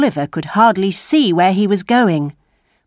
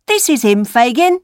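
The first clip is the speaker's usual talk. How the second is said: put-on lightly raised boy's voice; street boy; 5 semitones higher than usual. A narrator's reading. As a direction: introduce someone as a young lad. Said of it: "the Dodger said to the old man".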